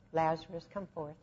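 soft, calm, unforced call; quiet command. Shown as a demonstration. Jesus calling Lazarus from the grave, quiet calm command. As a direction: say it low and simple, no scream. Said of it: "He doesn't have to scream at 'em".

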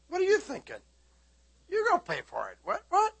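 hammed-up baffled protest, squeaking at the end; mock incredulous objection. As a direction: voice jumping high in disbelief. Acting objection to God's prompting.

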